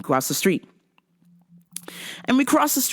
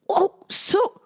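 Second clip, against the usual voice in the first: incredulous retort; 11 semitones higher than usual. Retold or imitated. Reply to insult retold.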